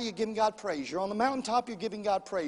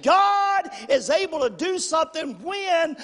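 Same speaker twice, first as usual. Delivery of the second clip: full-force preaching; 2 semitones higher, 6 dB louder than usual. Real, not acted. Preacher stirring the church to praise God, full-force preaching. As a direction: build the congregation up with loud, emphatic declarations.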